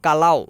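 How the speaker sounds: কালাও pronounced slowly for learners; কালাও with a clear final au; Malay word kalau. Shown as a demonstration. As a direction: say কালাও clearly, kah-lau. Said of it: "যেমন".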